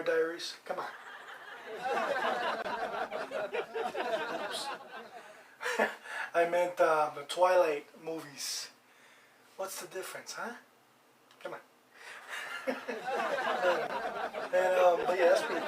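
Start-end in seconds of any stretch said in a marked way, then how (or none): 0.9-4.4 real, drawn-out fit of laughter; sustained amused laughing; long laugh. Real, not acted. Laughs at own mix-up. 12.0-14.5 real laugh, a few seconds long; drawn-out chuckling laugh; amused laugh. Real, not acted. Laughs after own joke.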